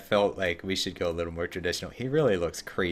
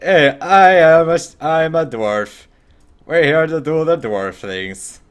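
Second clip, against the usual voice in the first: loud, higher hammed-up dwarf voice; mock dwarf; 8 semitones higher, 12 dB louder, slower than usual. Streamer joking about voicing a random dwarf, loud and hammed-up. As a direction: bellow like a silly dwarf. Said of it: "Just be like".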